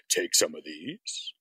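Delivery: low and slow like a devil offering pills; a devil doctor, low and slow; sly devilish doctor. Retold or imitated. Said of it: "He says".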